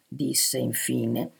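ordinary delivery

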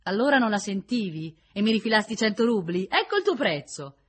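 loud, high, reproachful retort; bitter accusation. A narrator's reading. Woman reproaching the man who paid her, bitter. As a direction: hurl the old insult back at him.

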